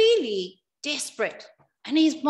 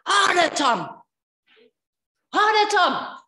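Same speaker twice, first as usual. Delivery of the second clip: loud, raised-pitch order; shouted demand; 6 semitones higher, 6 dB louder, slower than usual. Retold or imitated. Dad demanding his son strike harder, shouted demand. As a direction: shout it out as an urgent command.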